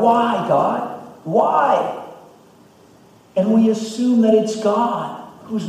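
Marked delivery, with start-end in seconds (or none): 0.0-1.9 high pleading why; mock crying out. Pastor voicing believers crying to God, high pleading. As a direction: play the frustrated believer. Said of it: "When we're crying out to God".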